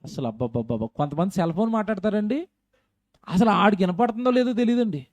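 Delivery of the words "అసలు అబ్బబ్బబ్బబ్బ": much softer, lower babbling mumble; mock the hushed unintelligible murmur; imitating people muttering secretly; mumbled whisper sound. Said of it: "ఇలా మాట్లాడుకుంటారండి".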